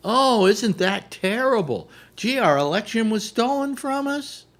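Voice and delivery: high, gushing, mock-amazed; ditzy anchor. OAN host interviewing Giuliani, high gushing mock amazement. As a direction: play the airhead host, wide-eyed. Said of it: "in depth with this woman".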